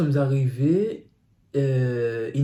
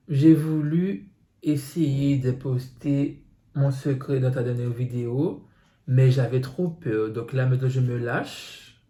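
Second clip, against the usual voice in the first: ordinary delivery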